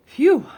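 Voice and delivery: big exhale of relief; loud phew of relief; loud relieved phew. A narrator's reading. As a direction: blow out the breath after squeezing out. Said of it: "said Amelia Bedelia".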